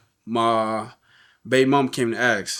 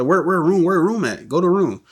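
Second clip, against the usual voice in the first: higher, louder urgent questions; urgent demand; 6 semitones higher, 4 dB louder than usual. Retold or imitated. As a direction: press her hard for the room. Urgently searching for mom.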